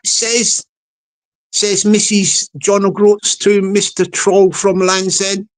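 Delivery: usual speaking voice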